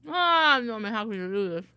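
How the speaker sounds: loud startled outburst; startled cry. Real, not acted. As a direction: yelp then protest loudly. Got jump scared.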